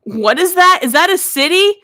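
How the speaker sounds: high, loud puppet-style outburst; loud disbelief. Acted. Puppet balks at odd town name.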